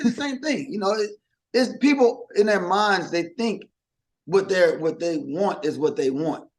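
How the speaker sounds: normal talking voice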